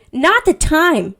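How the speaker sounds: drawn-out, loud, high scolding; play-acted loud telling-off; mock indignation. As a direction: scold loudly, stretching each word. Imagined outburst at Edward's bad timing.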